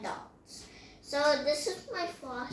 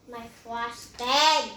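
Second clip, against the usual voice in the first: dismayed cry; 2 semitones lower, 9 dB louder, much slower than usual. Real, not acted. Kid dismayed over spilled frosting.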